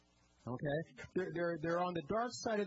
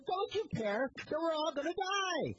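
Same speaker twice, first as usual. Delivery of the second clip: a retold desperate yell at a sleeping Jesus; panicked scream; 10 semitones higher than usual. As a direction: shout it like you think you're about to drown. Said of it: "they're screaming at him".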